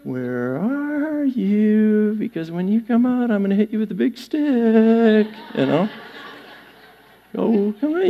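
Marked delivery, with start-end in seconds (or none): 0.0-5.3 sing-song coaxing with long, stretched vowels; mock-sweet, quiet beckoning; God luring Adam out. Shown as a demonstration. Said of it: "God was speaking this way". 7.4-8.1 softer, wheedling call to a pet; mock-sweet, quiet coaxing. Shown as a demonstration. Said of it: "you gotta coax your".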